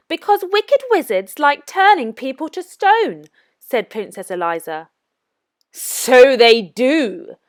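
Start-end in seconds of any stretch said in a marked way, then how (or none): 0.1-3.2 put-on higher, lighter voice of a young princess. A narrator's reading. Princess Eliza answers the wicked wizard, high and pert. 5.7-7.4 slow, drawn-out sinister wizard voice; sly wizard. A narrator's reading. Wizard taunts the princess, slow and sinister.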